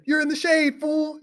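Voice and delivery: very high heckler's holler; mock yelling. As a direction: imitate a fan jeering at the wrestler. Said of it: "everybody started yelling at him".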